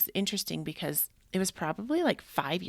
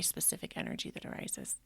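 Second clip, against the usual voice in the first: whispered repeat of the phrase; echoed whisper; 8 dB quieter than usual. Real, not acted. Podcaster echoing her own phrase to listeners, in a whisper. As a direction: let it drop to a whisper.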